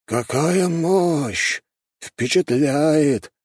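high, drawn-out wonder; awed exclamation. A narrator's reading. Red-bearded elder watches a distant golden flash, awed.

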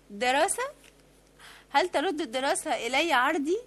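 صيحة غاضبة أعلى صوتا; اصرخ بها بعنف وغضب; هتاف عنيف. Acted. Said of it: "فهتفت بعنف". (تهتف بعنف في وجه المحقق غاضبة)

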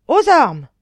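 high, very loud yell; howled alarm. Acted. Count jolted awake by the tramway's crash, howling alarm. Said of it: "hurla monsieur le comte".

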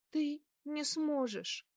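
squeezed out through tears, high and slow. A narrator's reading. Girl on the phone to the man coming for her, tearful. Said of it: "выдавила я сквозь слёзы".

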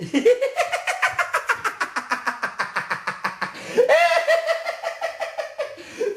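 long high cackling laugh, falling away; gleeful laugh. Acted. Pumuckl laughs after shoving the cat out, high gleeful cackle. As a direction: cackle with mischievous delight.

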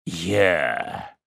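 low, slow, muffled hermit; hollow voice. A narrator's reading. Hermit tells the widow grim news, hollow and low. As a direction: sink the voice into a dark hush. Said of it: "глухим голосом".

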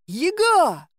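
high, loud alarmed call; a sudden high shout of her name; crying out. A narrator's reading. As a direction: cry out after her as she runs. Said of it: "только и успел вскрикнуть Гришка".

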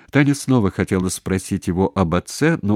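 ordinary delivery, nothing marked

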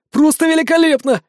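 high, loud delighted exclamation; booming delight. Acted. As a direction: shout with sheer delight. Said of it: "Абаляск загрохотал".

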